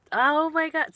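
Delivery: high, loud burst of oh my God; sudden exclamation. Real, not acted. Streamer interrupts her own story, loud startled exclamation. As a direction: break off with a startled outburst.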